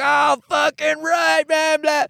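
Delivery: high, hooting bro cheer; hyped shout. Retold or imitated. Cops cheering the comic on, a high whooping bro shout. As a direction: whoop it up like a thrilled jock cop.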